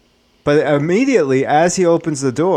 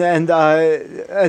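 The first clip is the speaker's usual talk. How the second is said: usual speaking voice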